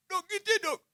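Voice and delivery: high, tearful mock wail of a patient; crying patient. Preacher voicing a crying cancer patient to the doctor, mock wail. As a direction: whimper like a woman just told she has cancer. Crying woman voiced in sermon.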